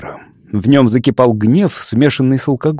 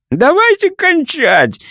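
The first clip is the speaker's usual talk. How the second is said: calling out; slower than usual. Acted. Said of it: "призвал он".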